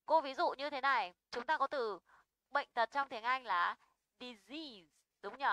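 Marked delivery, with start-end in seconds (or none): 4.2-4.9 "disease" with stress on the long final EASE; second-syllable stress. Shown as a demonstration. Teacher models English word for students, second-syllable stress. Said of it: "Trọng âm bao giờ cũng rơi vào âm dài".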